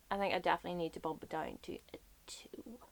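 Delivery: guilty little whisper; hushed sheepish aside. Real, not acted. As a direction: whisper it like a confession. Guilty aside admitting low rating.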